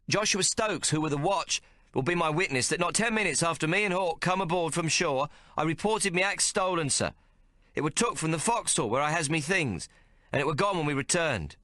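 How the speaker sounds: high, quick eager explanation; a boy pleading his case; young lad explaining. Acted.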